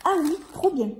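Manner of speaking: ordinary talk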